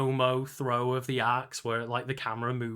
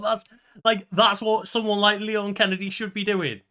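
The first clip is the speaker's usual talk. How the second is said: excited; 9 semitones higher, 5 dB louder than usual. Real, not acted. Excited agreement, high pitch.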